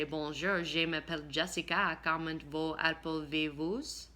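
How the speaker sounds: slow, hammed-up French accent from an English schoolgirl; shy student mangling French at Heather, bad French accent. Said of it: "in a very bad French accent".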